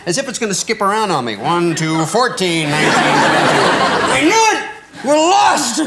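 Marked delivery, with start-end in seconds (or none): 1.4-4.2 counting floors with mounting alarm, a bit slow; mock alarmed counting. Comedian in the lift counting floors in mock alarm. 4.2-5.8 much higher, louder mock panic cry; hammed-up terror at a lost lift. Comedian on stage acting lift panic, hammed-up fear.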